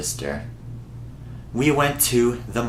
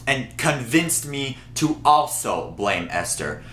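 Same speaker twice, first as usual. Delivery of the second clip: ordinary talk, nothing marked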